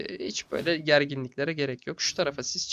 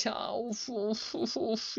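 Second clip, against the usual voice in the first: groan of frustration; 8 semitones higher, 7 dB quieter, much slower than usual. Real, not acted. Groaning after misclick.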